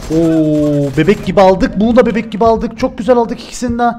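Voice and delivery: excited. Real, not acted. Two quick kills in the game.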